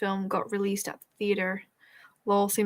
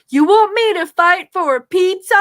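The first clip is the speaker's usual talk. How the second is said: high, loud incredulous quote; TikTok sound impression; 9 semitones higher, 12 dB louder than usual. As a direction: shout it in disbelief. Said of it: "TikTok sound that goes".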